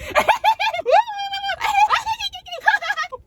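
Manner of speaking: put-on shrill, wild cackling like a drunk hyena; hyena cackle. Imitating the drunk girls as hyenas, high wild cackle. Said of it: "they are some fucking wild-ass drunk hyenas".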